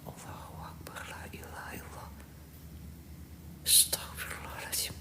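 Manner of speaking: barely audible frightened whisper. Real, not acted. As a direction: pray in a terrified whisper. Scared prayer in haunted house.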